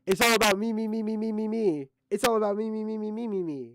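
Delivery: high taunting me-me-me chant; mocking his self-focus. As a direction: mimic him as a whiny narcissist. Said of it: "It's all about you".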